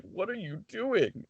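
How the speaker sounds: high, slow, baffled question; baffled crowd. Retold or imitated. Retold baffled crowd reaction.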